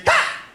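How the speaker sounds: short, very high mock shriek; jump-scare "Ah!", imitated high; sudden high yelp, acting the scarer; imitated scare scream. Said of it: "she was like".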